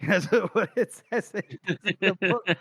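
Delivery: ordinary talk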